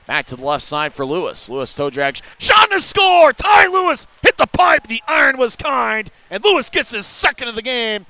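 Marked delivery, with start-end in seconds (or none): 2.4-8.1 high, loud goal call; goal call shout. Real, not acted. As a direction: shout the score to the crowd. Goal call in hockey broadcast.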